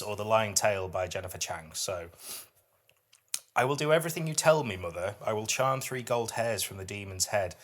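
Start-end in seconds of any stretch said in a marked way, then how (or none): 3.5-7.5 higher, louder, measured poem recital; poetry reading. Acted. Podcast host reads a dark fairy-tale poem to her co-host, recited with weight. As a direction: read it like a performed poem, each image weighed.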